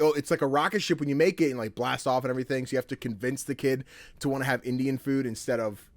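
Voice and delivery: ordinary delivery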